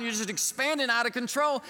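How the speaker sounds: ordinary delivery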